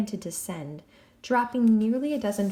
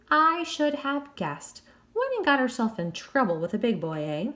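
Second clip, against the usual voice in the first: put-on smirking English accent; 4 semitones higher than usual. Jack smirks about Relda.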